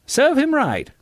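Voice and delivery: high-pitched crowd jeer; high, excited cheer; crying out. A narrator's reading. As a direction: cry it high, egging him on. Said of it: "cried the Clevers".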